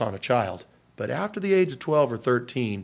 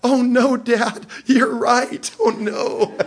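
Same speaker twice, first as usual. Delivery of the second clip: put-on high, louder, laughing-through-it teen voice; laughing daughter; 10 semitones higher, 5 dB louder, much slower than usual. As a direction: squeal it like a daughter cracking up. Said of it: "She's laughing, and she goes".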